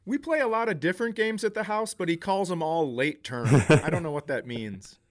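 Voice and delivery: ordinary delivery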